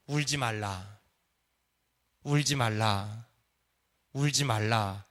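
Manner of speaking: very low, drawn-out solemn words; low compassionate voice of Jesus. Retold or imitated. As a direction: speak slowly and deep, with pity. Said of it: "불쌍히 여기면서 뭐라 그러는지 아세요".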